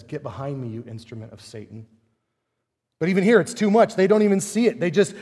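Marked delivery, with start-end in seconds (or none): 0.0-1.9 soft, hushed rebuke; Jesus's rebuke. Retold or imitated. Jesus rebuking Peter, hushed and stern. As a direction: drop it low, a quiet stern rebuke. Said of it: "And Jesus says".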